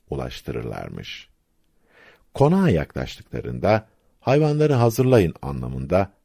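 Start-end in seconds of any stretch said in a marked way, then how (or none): 4.2-5.3 a mild shouted order, pitched higher; lightly raised narrator's shout; calling out. A narrator's reading. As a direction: call it out as a rider's order. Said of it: "nara atarlar".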